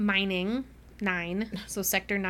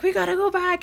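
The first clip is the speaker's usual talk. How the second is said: urgent plea; 10 semitones higher, 6 dB louder than usual. Retold or imitated. Thorn urging to return.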